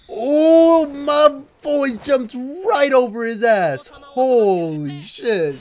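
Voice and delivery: very loud, high, stretched-out cheer; astonished hollering. Real, not acted. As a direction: holler in amazement at a huge jump. Amazed reaction to a jump.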